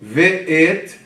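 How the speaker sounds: Ve'et loud, split as "ve" and "et"; syllable division. Shown as a demonstration. Teacher showing the syllable division of Ve'et, clearly split. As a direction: separate the two syllables of Ve'et, rising at the end. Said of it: "Olha a divisão silábica".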